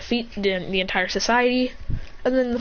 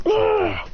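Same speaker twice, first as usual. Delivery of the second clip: high acted grunt; struggling grunt; 11 semitones higher than usual. Villain fighting snakes on legs, struggling grunt.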